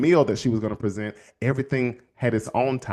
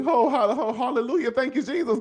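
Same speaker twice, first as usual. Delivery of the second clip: much higher, giggling through hallelujah; laughing praise; 12 semitones higher than usual. Retold or imitated. Speaker praising Jesus, laughing and higher.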